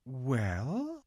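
hesitant, drawn-out rising word; stall, reluctant to be hard on him; slow, careful hesitation; rather slowly. A narrator's reading. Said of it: "said Lucy rather slowly".

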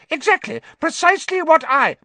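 high, loud, squeaky cry; shrill frightened rat-man squeal. A narrator's reading. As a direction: squeal in terror. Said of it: "nodding frantically at her".

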